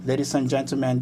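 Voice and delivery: usual speaking voice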